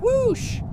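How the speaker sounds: rocket whoosh, pitched high. Pretend. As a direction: launch the whoosh upward. Vehicle sound imitation.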